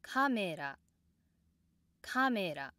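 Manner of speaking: Camera starts high, then steps down; accent on first sound. Shown as a demonstration. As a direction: drop the pitch right after the first sound of Camera. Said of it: "start high to allow the downstep".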